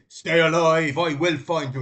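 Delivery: put-on loud, called-out line in a vague Irish-North-American accent; weird Irish accent. Quoting the hero's movie farewell, loud in a hazy Irish accent. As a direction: shout it like the movie hero in a muddled Irish accent. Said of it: "in this weird, um, sort of Irish".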